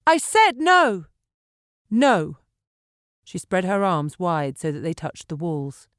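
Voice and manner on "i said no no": high, loud, slow I said no; insistent shout. A narrator's reading. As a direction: hammer it out, shouting.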